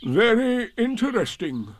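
slow gloomy donkey voice; Eeyore voice. Acted. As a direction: drag it out, gloomy and glum. Eeyore's voice in story.

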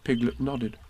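usual speaking voice

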